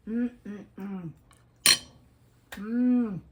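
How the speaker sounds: loud pleased humming; yum hum. Real, not acted. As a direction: hum with delight. Tasting food.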